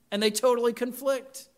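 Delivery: normal talking voice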